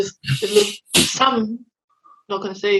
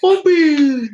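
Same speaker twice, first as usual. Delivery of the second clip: higher, drawn-out mock call; mimicked call; 5 semitones higher, 3 dB louder than usual. Host repeating a film character's call, drawn-out mimicry.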